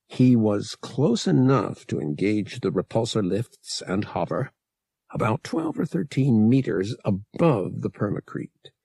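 plain reading voice

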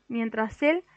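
plain reading voice

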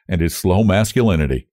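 usual speaking voice

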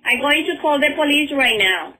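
indignant shout at the caller. Retold or imitated. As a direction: push it up and loud like a shouted warning. Same clip replayed louder, higher.

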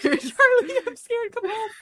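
shrill high cry for help; panicked damsel. Pretend. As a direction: scream for Charlie in mock panic. Mock damsel calling for Charlie.

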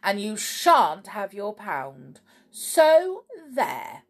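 loud, stern refusal rising high; scolding with a defiant finish; severe. A narrator's reading. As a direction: lay down the punishment crossly. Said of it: "said Snap severely".

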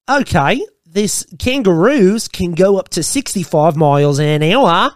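hammed-up Aussie Vine guy; broad, overdone Australian accent; exaggerated Australian accent. Imitating a guy from Vine, exaggerated Australian accent. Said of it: "so exaggerated".

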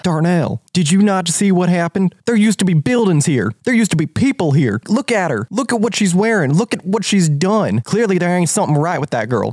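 insistent, high-swinging country voice; alarmed country villager. Acted. Villager points Darnell at the strange girl, agitated and high. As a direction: press each point with rising alarm.

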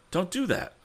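sharp cry, pitched high; urgent high cry to a prowler at his car; cried out. A narrator's reading. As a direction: call out to stop him. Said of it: "cried Tom".

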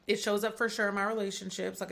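ordinary talk

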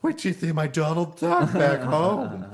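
high, loud flamboyant cartoon voice; Snagglepuss voice. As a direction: camp it up like a cartoon.

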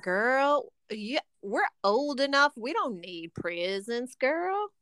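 a hint of Southern drawl on girl; sassy Southern friend, lightly done; light Southern accent. Friend telling friend no presents needed, in a light Southern accent. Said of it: "I went into a Southern accent there".

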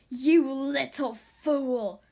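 slow, loud villain yell. Acted. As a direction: bellow it out, dragging each word. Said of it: "she yelled".

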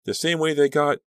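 high, loud, breaking off in horror; horrified. A narrator's reading. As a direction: repeat it in horror and stop short.

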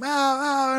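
imitated karate kiai shouts, pitched high. Fighters shouting in the karate dojo, imitated kiai. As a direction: bark out sharp training cries from the dojo. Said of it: "They were in there going".

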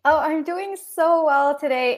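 ordinary talk

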